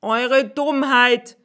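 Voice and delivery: cross schoolmaster calling out; schoolmaster's cry. Acted. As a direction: fling it at them. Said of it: "rief der Schulmeister".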